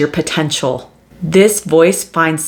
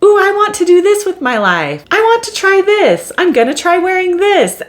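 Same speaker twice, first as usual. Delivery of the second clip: put-on much higher, excited little kid; little child voice; 14 semitones higher than usual. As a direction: squeal it like a small child showing off. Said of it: "your really young childhood self".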